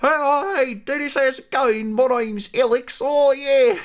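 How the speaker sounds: put-on very high, silly Australian-tinged accent; Alex, botched Australian-sounding British. Gamer answering himself as friend Alex, botched Australian-sounding accent. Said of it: "That sounds more Australian".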